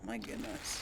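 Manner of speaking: breathy hushed "my goodness"; whispered awe. Real, not acted. Gamer admiring the windmill, in an awed whisper. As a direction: whisper it under your breath, impressed.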